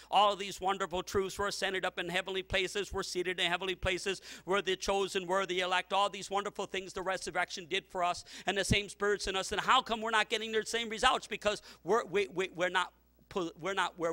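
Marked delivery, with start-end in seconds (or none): none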